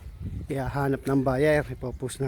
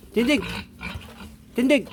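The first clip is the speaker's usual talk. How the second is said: drill sergeant; 7 semitones higher than usual. Real, not acted. Commanding pigs to stand.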